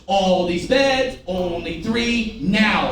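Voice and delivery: "All these beds, only three. Now" drawn out in even beats; slow rhythmic "All these beds, only three. Now"; slow demonstrated rhythm. Shown as a demonstration. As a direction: stretch "beds" and "three", then land on "Now". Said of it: "So watch".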